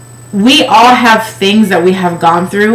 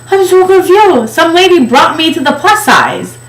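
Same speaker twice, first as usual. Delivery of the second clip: much higher, whiny mock crying voice; mock whine; 9 semitones higher than usual. Crying girl telling her boyfriend, high whiny mock whimper. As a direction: whimper it like a tearful kid.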